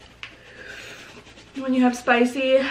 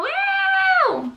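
excited. Real, not acted. Celebrating subscribers.

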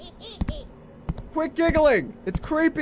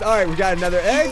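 ordinary delivery

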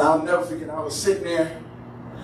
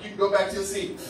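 ordinary delivery

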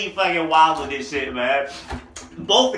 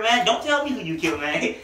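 usual speaking voice

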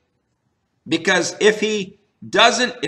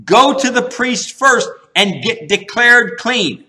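higher, louder urgent orders; urgent command; 3 semitones higher, 3 dB louder than usual. Retold or imitated. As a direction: push him out the door with your voice. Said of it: "it's like Jesus is pushing him".

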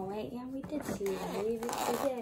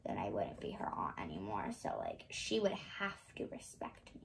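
unvoiced secret whisper; 13 dB quieter than usual. Real, not acted.

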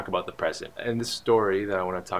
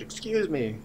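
raised-pitch attention-getter; higher-pitched, moderately raised excuse me; kinda loud; 7 semitones higher, slower than usual. Retold or imitated. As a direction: call out mildly to a driver. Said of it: "kinda loud".

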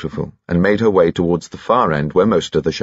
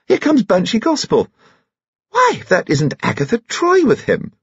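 animated grande dame with swooping high pitch; gossiping society lady; 4 semitones higher than usual. Acted. Lady gossips about a failed ball and new arrivals, animated. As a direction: chatter confidingly, leaping up on new sightings.

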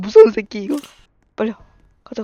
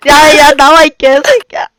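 loud, raised-pitch challenge; angry shouting; 4 semitones higher, 8 dB louder, slower than usual. Real, not acted. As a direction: yell at the monster to get out. Shouting at the monster. (버럭 지르는 고함)

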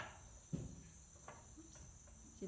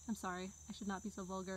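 usual speaking voice

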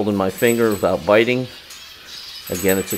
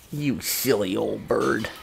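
slow, much softer tender coo; 6 dB quieter, slower than usual. Real, not acted. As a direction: murmur it fondly to a pet. Cooing to the parrot.

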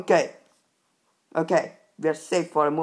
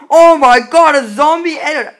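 loud, higher zombie warning; alarmed shout; 7 semitones higher, 12 dB louder than usual. Real, not acted. Gamer spotting a zombie, loud alarmed shout. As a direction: shout the alert at full tilt.

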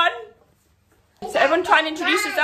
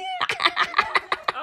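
high giggle. Real, not acted. Laughing after squeal.

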